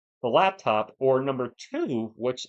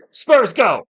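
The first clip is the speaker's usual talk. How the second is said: belted, much higher and louder Spurs chant; loud shout; 9 semitones higher, 14 dB louder than usual. Shown as a demonstration. Streamer shouts to test mic blowout, full-volume chant. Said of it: "We gotta do a loudness test".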